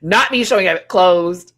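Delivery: loud giggly repeat. Real, not acted. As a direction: blurt it again mid-laugh. Repeats line, louder, laughing.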